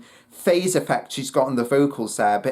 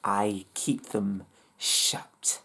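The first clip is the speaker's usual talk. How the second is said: whisper; 6 semitones lower, 7 dB quieter, slower than usual. Retold or imitated. Reading lyrics, whispering the echo.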